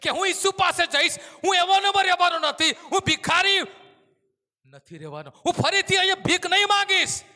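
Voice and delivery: loud, high hopeful vow; full of expectation. Acted. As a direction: declare that life will change. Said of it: "અપેક્ષાથી ભરાયેલો".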